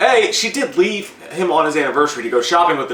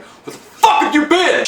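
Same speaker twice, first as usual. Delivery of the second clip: high, drawn-out yell at the wife; angry husband's yell; 11 semitones higher, slower than usual. Retold or imitated. Drunk husband confronting his late wife, angry high-pitched yell. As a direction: demand it high and incredulous.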